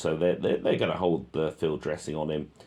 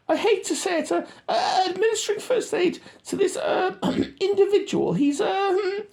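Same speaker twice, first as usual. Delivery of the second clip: much higher, louder mock radio talk; squeaky radio voice; 5 dB louder than usual. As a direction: mimic a soldier on the radio, squeaky. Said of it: "he's on the radio again".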